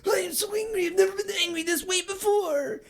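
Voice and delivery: high angry Ren. Retold or imitated. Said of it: "Rin's like".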